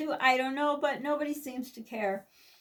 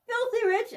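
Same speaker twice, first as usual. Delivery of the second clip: FILTHY pitched much higher in outrage; indignant emphasis; 9 semitones higher than usual. Real, not acted. Complaining to viewers about rich relatives, high indignant emphasis.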